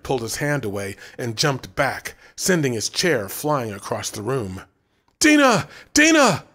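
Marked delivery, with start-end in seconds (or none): 5.2-6.4 high, loud panicked calling; frantic call. A narrator's reading. Brent calling Dina in panic from across the room. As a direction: yell for help in panic. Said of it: "the clerk called, frantically".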